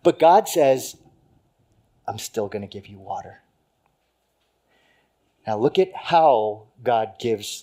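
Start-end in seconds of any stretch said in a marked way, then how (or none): 2.1-3.4 gentle voice of God. Acted. God's gentle reply repeated.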